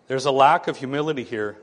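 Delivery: ordinary talk, nothing marked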